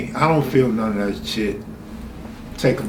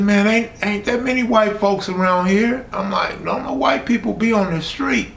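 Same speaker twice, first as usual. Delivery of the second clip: retold alarm; 8 semitones higher than usual. Retold alarmed argument with his mom.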